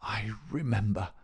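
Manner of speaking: weak, breath-starved whisper; gasped. Acted. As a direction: gasp it out feebly. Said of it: "Holmes gasped".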